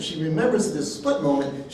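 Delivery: normal talking voice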